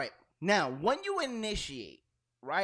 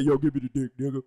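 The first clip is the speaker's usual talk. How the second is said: lightly lowered mock voice of the co-host; imitating changed voice; 4 semitones lower, faster than usual. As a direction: mimic her suddenly lower tough voice. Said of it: "hear her voice change".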